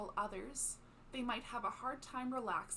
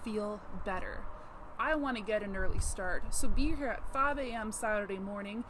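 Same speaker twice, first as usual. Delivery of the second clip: much louder, scornful and pushy; loud aggressive brush-off; 13 dB louder than usual. Acted. Neighbour loudly dismissing Jimmy's bad back, bossy and loud. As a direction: steamroll him with a loud bossy voice.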